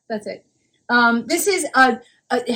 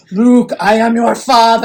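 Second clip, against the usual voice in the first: slow, louder menacing villain voice; Darth Vader impression; 2 semitones lower, 6 dB louder than usual. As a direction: deliver it slow like a dark lord revealing the truth. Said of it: "Darth Vader".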